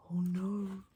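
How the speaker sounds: plain reading voice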